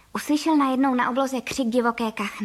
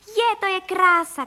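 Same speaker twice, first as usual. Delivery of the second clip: high, loud, drawn-out exclamation; joyful child's high call; delighted shouting; 7 semitones higher, 5 dB louder than usual. Acted. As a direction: cry out in slow, stretched wonder. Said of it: "křičel Vilda".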